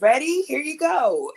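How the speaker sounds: high, loud, chirpy event planner. Retold or imitated. Event planner hands the breathless singer the mic, chirpy and loud. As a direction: sing it out like a cheerful organizer.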